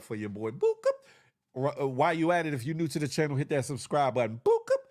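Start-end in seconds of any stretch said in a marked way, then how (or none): 0.5-1.0 squeaky high boop sound effect; playful high boop. Pretend. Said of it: "thumbs up sound". 4.4-4.8 squeaky high boop sound effect; playful high boop. Pretend. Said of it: "thumbs up sound".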